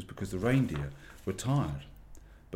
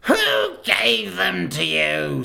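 slow, high, loud demand; menacing witch; 11 semitones higher, 10 dB louder, much slower than usual. A narrator's reading. Witch queen interrogates the fox, slow and menacing. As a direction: drag out the question threateningly. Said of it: "said the witch".

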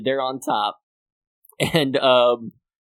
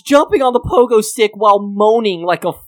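higher, louder, fast excited retelling; 6 semitones higher, 5 dB louder than usual. Real, not acted. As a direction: race through it, worked up. Excitedly retelling pogo scene.

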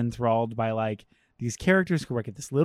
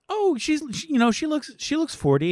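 lightly voiced gossip, pitched higher; a hint of a catty busybody, higher; gossipy coworker; 11 semitones higher than usual. Retelling the gossip twisting words about a woman, higher catty voice.

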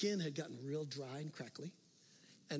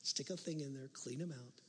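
normal talking voice

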